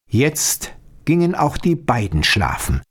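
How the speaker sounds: ordinary delivery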